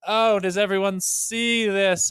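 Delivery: hammed-up drama class voice mocking a flop; soft guy voice. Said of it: "My soft guy voice".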